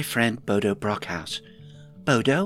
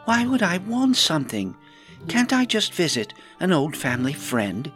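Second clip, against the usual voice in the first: high-pitched protest of innocence; mock-wounded high indignation; offended; 8 semitones higher than usual. A narrator's reading. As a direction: protest as if wrongly accused, voice raised in pitch. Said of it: "Rowan looked offended".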